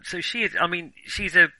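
ordinary talk

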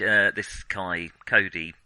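normal talking voice

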